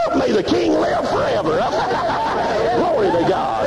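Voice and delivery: high, shouted, laughing whoop; preaching at full force. Acted. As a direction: lift the church with joyful hollering. Preacher tells story hollering.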